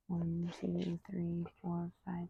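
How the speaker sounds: counted in a whisper. Real, not acted. Trainer whispering the count while the dog holds.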